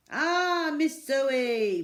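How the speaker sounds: high, loud cheery shopkeeper greeting; loud delighted shopkeeper voice; Raj proclaiming. A narrator's reading. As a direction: hail her warmly from behind the counter. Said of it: "proclaimed Raj".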